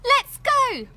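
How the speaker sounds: high, loud, excited call; Katy urging her friend on; eager girl. A narrator's reading. Katy calling to Hadrosaurus, high and excited. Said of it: "said Katy".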